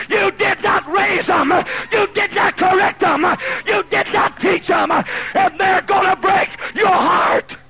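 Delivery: high shouted preaching, slowed and hammered; preaching at full force. Acted. Preacher warning parents about raising children, shouted high and slow. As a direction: thunder the warning at the congregation.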